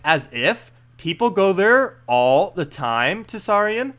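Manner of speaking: drawn-out mock teen-girl talk, questioning lilt; slow, exaggerated hipster teen girl. Streamer as princess scoffing at Tisarian, hipster teen girl voice. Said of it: "I don't know how to talk like this".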